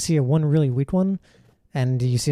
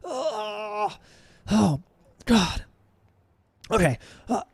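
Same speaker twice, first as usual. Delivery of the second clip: alarmed guard; 6 semitones higher, much slower than usual. Acted. Guard sees distant trouble.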